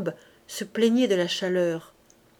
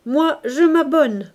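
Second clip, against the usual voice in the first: ordinary delivery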